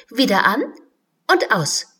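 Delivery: loud, playful command. Acted. Alice commands the cave light, loud and playful. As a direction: order the light on and off. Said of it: "befahl Alice ein um das andere Mal".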